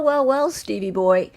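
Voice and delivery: normal talking voice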